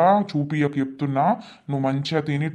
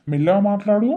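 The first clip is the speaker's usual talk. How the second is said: higher, louder pleading; calm her down, a bit exasperated; raised plea; 5 semitones higher, 5 dB louder than usual. Acted. Husband pleads with angry wife.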